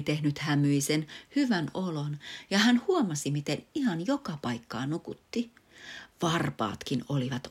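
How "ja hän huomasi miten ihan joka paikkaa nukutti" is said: ordinary delivery, nothing marked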